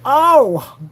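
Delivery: loud high wail of mock despair; mock anguished cry. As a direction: cry out like a sore loser.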